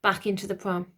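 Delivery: plain reading voice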